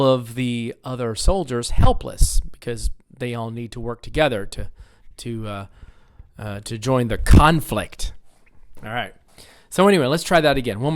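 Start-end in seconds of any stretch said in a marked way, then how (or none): none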